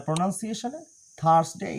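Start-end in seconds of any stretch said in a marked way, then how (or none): 1.2-1.7 "থার্সডে" with the R sounded; American "থার্সডে" as THURS-day with R; American pronunciation. Shown as a demonstration. Teacher contrasts with British, American "থার্সডে". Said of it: "American".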